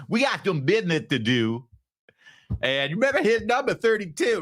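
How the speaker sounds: high, exaggerated mock Detroit accent; mocking put-on accent. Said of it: "notice how the accent's gone now".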